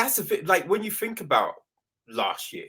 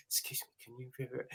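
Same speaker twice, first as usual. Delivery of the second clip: much softer, fast polite mumble; meek request; 5 semitones lower, 18 dB quieter, faster than usual. Retold or imitated. New usher asking moviegoers to hush, very softly. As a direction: barely dare to ask. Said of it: "you know, like".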